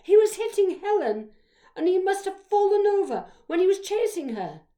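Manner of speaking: high, loud, insistent report; speaking up. A narrator's reading. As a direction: speak up loudly as a witness. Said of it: "she'd spoke up straight away".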